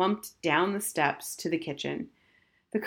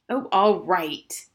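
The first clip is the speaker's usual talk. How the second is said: loud, slow, exasperated surrender; 6 dB louder, much slower than usual. Acted. Husband told again to get car, exasperated. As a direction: drag it out with heavy irritation.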